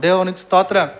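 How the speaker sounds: ordinary delivery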